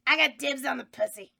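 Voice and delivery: loud punchline. Acted. Elvira's crude punchline, much louder.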